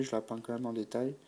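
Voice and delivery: normal talking voice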